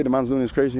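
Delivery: ordinary talk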